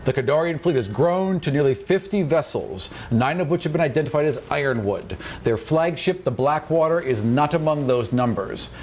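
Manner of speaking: ordinary talk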